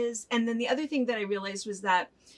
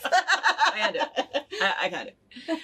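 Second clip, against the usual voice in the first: laughing repeat; 5 semitones higher, 4 dB louder than usual. Retold or imitated. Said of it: "repeats the child's line laughing".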